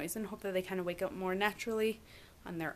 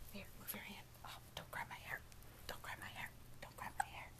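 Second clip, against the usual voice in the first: whispered protest; 14 dB quieter, faster than usual. Real, not acted. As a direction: hush it, keep it whispered. Baby grabbing her hair.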